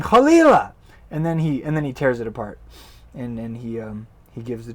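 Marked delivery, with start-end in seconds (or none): 0.0-0.7 chalila shouted high and loud; outraged exclamation. Acted. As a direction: cry out chalila in disgust. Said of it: "he reacts by saying".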